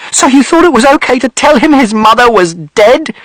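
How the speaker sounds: furious accusation; mother shouting. A narrator's reading. Mother shouting at father about the lie, outraged. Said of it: "And mother shouted".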